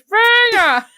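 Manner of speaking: high, loud goofy yell; Joselito. Retold or imitated. Co-host re-enacting her promo blurt for the laugh, goofy high shout. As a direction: blurt it as an over-the-top shouted imitation. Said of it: "foi um venha muito Joselito".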